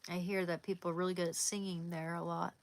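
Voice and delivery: ordinary delivery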